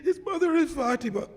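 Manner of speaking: high, tear-choked voice; tearful. Real, not acted. Preacher repeating the Prophet's words on Fatima, tearful. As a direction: struggle to resume through real tears. Resumes after sobbing pause.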